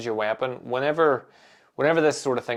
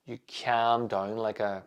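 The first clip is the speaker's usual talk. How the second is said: ordinary talk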